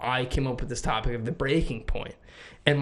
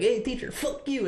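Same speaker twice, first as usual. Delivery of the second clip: high, cocky jab at the teacher; defiant to teacher; 10 semitones higher than usual. Retold or imitated. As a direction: toss it off like a mouthy kid. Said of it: "I would be like".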